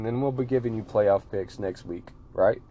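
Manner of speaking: usual speaking voice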